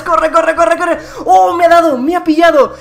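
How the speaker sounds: frantic shouts while fleeing; being chased. Real, not acted. Fleeing the banana in game.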